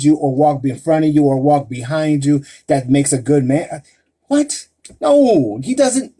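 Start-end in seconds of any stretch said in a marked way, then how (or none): 4.3-6.1 surprised protest. Real, not acted. Sudden protest at listener comment.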